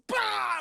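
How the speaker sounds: loud, high "bah"; shouted impact noise. Retold or imitated. Storyteller imitating a dirty punch, shouted noise. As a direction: shout the sound of a sudden jab.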